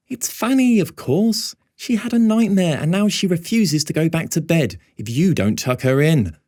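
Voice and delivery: high, blaming mother voice, slowing at the end; mother accusing her son over the phone; accusatory mother. A narrator's reading. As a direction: lay the blame on him. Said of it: "she tells me accusatorially".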